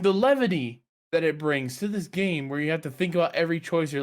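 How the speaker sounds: usual speaking voice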